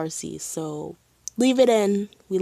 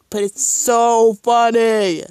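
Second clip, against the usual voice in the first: loud, drawn-out, higher gush; gushing amusement; 4 semitones higher, 10 dB louder, slower than usual. Real, not acted. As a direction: stretch it out with loud delight. Gushing over a funny scene.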